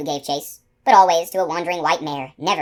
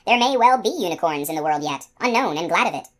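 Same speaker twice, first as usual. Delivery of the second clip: ordinary delivery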